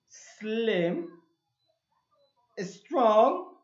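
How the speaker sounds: loud, spaced-out word list; vocabulary callout. Shown as a demonstration. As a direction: call out each word for the class. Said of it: "vamos a ver las palabras". (lista de vocabulario en voz alta)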